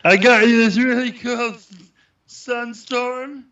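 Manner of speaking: high-pitched, sugary gift-giving voice; silly high voice. Pretend. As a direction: chirp it out sweet and eager. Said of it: "Why are we talking like that?".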